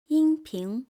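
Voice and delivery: "音平" held high and steady; first tone. Shown as a demonstration. Teacher names the first tone, high and steady. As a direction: say "音平" on high, level pitch. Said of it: "high and steady".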